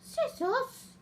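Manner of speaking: talking to a dog. Real, not acted. Calling the dog high.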